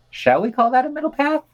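lifted, mock-agreeable tone; wry irony. Real, not acted. Host teasing a tame protest, wry mock approval. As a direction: float it up, tongue in cheek.

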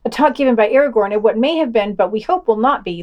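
ordinary delivery

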